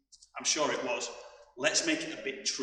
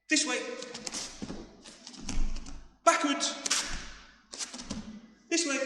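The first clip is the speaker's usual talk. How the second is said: called out; 12 semitones higher than usual. Real, not acted. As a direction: shout each direction to the class. Coach calling out directions.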